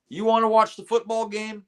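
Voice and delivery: high taunting drill sergeant; mock the private from on high.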